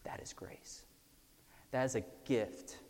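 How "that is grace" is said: whispered, soft emphasis. Real, not acted. Preacher telling the congregation what grace is, whispered. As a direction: breathe it out as a whisper. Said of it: "whispered punchline".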